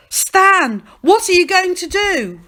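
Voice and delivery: high, loud cry of alarm; alarmed shout. A narrator's reading. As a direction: shout anxiously across the crowd. Calling alarmed to the boy.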